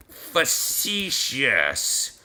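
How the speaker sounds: "facetious" stretched very slowly, syllable by syllable; very slow, hesitant "facetious"; sounding out. Real, not acted. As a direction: draw out "facetious" into long separate sounds. Sounding out word to spell it.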